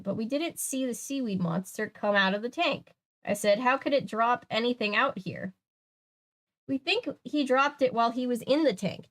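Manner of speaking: animated read-aloud, deliberate phrasing; story narration. A narrator's reading. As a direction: read the mystery out to listeners with lift.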